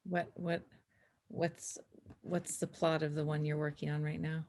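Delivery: ordinary talk, nothing marked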